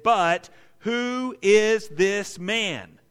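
WHO IS THIS MAN, very slow, loud and high; emphatic question. Acted. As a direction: hammer "who is this man" one word at a time. Preacher stresses the gospel's recurring question.

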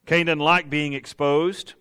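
ordinary talk

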